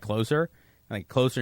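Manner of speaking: ordinary delivery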